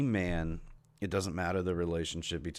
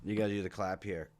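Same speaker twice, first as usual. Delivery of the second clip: ordinary talk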